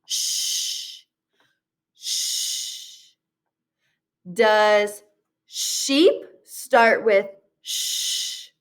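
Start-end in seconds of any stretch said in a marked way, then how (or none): 0.0-3.1 hushing unvoiced sh-, drawn out; isolated SH sound. Shown as a demonstration. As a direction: shush it out like sh-.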